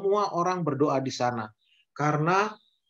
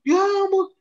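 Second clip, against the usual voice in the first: shocked gasp; 12 semitones higher, 4 dB louder than usual. Acted. Shocked at the temple uproar.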